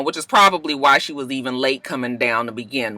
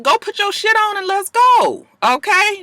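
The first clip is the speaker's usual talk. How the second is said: exasperated. Retold or imitated. Exasperated at late Nene.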